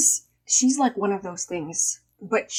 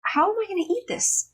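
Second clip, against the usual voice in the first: pitched up high, mock-outraged whine; high dismay; 11 semitones higher than usual. Retelling mom's reaction, high mock-outraged whine. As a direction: squeak it out in disbelief over tiny bagels. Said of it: "And I was like".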